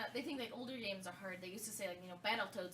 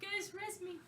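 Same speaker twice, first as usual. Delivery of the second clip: light, high-pitched ghostly plea; a hint of a forlorn ghost begging; sad ghost; 11 semitones higher, slower than usual. Dead player floating over tombstone, lightly voiced sad ghost. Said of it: "I remember being a sad ghost".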